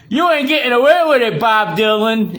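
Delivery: high, loud yell, slowing on the name; furious shout. Real, not acted. Ranting at [name], a furious high shout. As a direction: scream it at him like a threat.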